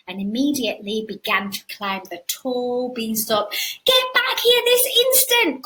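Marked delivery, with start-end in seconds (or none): 3.8-5.6 high, loud, stern mother calling out; high calling mum. Acted. Mum calling Jack back down the beanstalk, high and stern. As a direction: yell it after a runaway boy. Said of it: "called Jack's mum".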